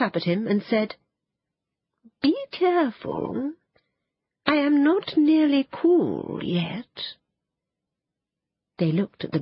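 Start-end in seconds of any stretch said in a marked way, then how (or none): 2.2-7.2 slow, high, otherworldly bird voice; a magical bird warning them gently, drawn out; phoenix voice. A narrator's reading. The phoenix speaks from the fire.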